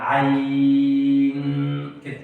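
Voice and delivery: عين slow and drawn out on the ي; correct madd. Shown as a demonstration. Teacher giving the right reading to student, slowly chanted. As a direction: chant عين with a long held vowel. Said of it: "مش عين ولا عين".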